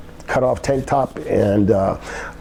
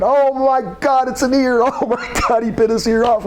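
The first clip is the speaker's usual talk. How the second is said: screaming in horror; 13 semitones higher than usual. Retold or imitated. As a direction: shriek it like you can't believe it. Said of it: "starts screaming".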